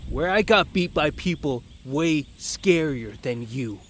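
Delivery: ordinary talk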